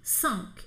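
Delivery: "Cinq" said alone, short and clipped; French cinq. Shown as a demonstration. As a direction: model the single French "Cinq". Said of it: "o cinco é cinq".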